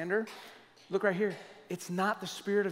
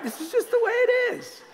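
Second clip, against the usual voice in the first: high mocking voice; 6 dB louder than usual. Mocking his own excuse.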